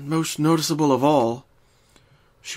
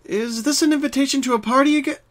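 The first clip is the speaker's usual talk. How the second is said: put-on higher, bright young mare voice; Twilight voice; 13 semitones higher, 4 dB louder than usual. Twilight opens the door to Pinkie, higher friendly voice. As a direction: voice Twilight greeting her friend.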